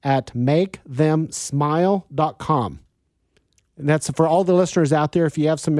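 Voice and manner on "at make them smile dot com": very slow, spelling out an email address; giving his email to a caller, slow and careful; slow dictation. Real, not acted. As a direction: dictate it slowly for the caller to write down. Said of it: "How would I email you?".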